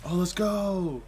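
loud, high cheer of victory; triumphant cheer. Real, not acted. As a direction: celebrate the win, loud and high. Cheering a winning moment.

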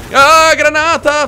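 loud, high, drawn-out yell; alarmed shout. Real, not acted. Player spotting a grenade, alarmed shout. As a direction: yell a slow, stretched warning.

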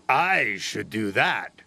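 engine character voice. A narrator's reading. Gordon's rival claims the job.

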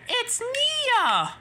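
very high, loud reveal; astonished exclamation. Acted. Percy reveals the ghost is Nia, exclaiming. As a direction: cry out the discovery.

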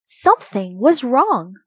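ordinary delivery, nothing marked

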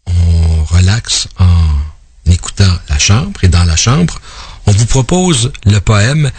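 low, slow, drawn-out introduction; mock-soothing poetry host. Radio host introducing a relaxing poem, mock-soothing.

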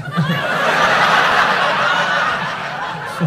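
short airy laugh at his own joke; breathy laugh. Real, not acted. Laughing after sacked joke.

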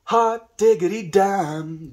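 high, slow delighted cry; drawn-out happy exclamation; excited exclamation. Real, not acted. Reacting to joint hit.

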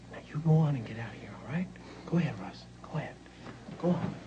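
soft, low near-whisper; hushed dismissal. Acted. Singer quietly sending the brother away, hushed dismissal. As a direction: usher him out under your breath.

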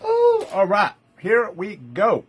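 high squealed ooh, then slow here we go; excited start. Real, not acted. Seller launching first item to viewers, high excited squeal. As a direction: burst into it like the show begins.

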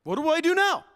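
put-on high, panicky boy's question; David over fallen Goliath, high and flustered. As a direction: blurt it out at a loss. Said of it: "David runs up to him like".

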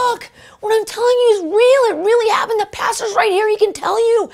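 high-pitched, fervent revival preaching; preaching with all my might. Retold or imitated. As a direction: preach like you're drilling it into them. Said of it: "I'm preaching it with all my might".

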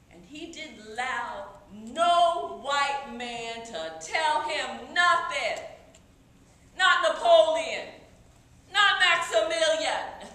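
loud, emphatic declaration; proud defiance. Acted. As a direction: hammer out each refusal with force. Proudly listing whom Toussaint defied.

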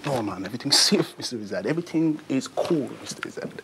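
easygoing Jamaican reassurance; laid-back Jamaican voice, all is fine; Jamaican accent. Acted. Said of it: "I can do a Jamaican accent".

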